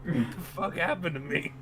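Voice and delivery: put-on loud, high, dazed drunk voice; bewildered drunk. Drunk patient wakes and asks the bar, dazed loud. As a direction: ramble like a confused drunk.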